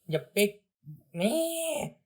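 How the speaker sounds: high, puffed-up bratty voice; a defeated brat saving face; indignant kid villain. Acted. Bowser Junior sputtering.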